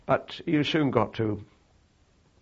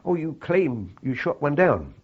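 higher, slower mock-questioning officer; imitating interrogating officer; 6 semitones higher, 4 dB louder than usual. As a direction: quote her sceptical challenge, drawn out and raised. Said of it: "And she said".

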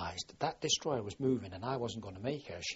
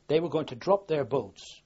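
ordinary talk, nothing marked

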